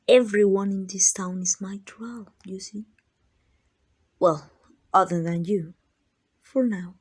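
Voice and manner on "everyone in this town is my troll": usual speaking voice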